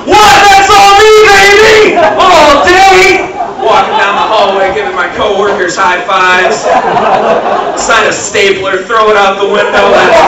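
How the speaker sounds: loud high gloating celebration; triumphant shouting. Pretend. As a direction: celebrate like you just scored. Office victory celebration.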